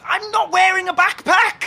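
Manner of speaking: high, slow, emphatic protest; exasperated shout. Acted. Friend being mistaken for a backpack wearer, exasperated. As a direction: spell it out at a high, exasperated pitch.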